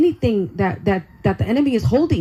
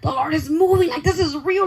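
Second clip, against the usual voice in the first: excited; 7 semitones higher than usual. Retold or imitated. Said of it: "Like, I was so excited".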